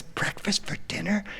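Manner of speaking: put-on hushed child's amazement; awed whisper. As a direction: breathe it out like a dazzled little kid. Son amazed by waitress's offer.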